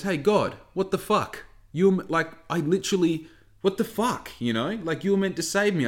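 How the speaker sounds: high-pitched mock outrage; acted indignant protest. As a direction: confront God, baffled and aggrieved. Drowned man confronts God in fable.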